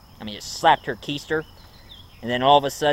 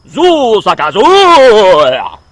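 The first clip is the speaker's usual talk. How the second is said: much higher, much louder, slow drawn-out eerie cry; ghostly Zool call; 7 semitones higher, 14 dB louder, much slower than usual. Retold or imitated. Vlogger imitating the Zool sound from the fridge, loud slow eerie wail. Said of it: "it was like".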